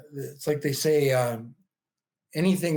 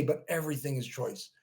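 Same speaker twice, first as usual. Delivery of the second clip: softer, leaning into everything, stretching choice; quiet emphasis; 3 semitones lower, 7 dB quieter than usual. Real, not acted. Coach telling listeners happiness is a choice, quiet and firm. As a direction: drop the volume and land it with weight. Repeats the point, quieter and weightier.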